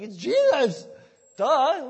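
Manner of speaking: loud, high mock-exasperated crowd; duh, obviously. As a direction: blurt it out like it's painfully obvious. Acting out the crowd's obvious answer.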